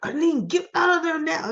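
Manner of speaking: high, urgent mock command; urgent order. Ally on the phone warns her, mock urgent.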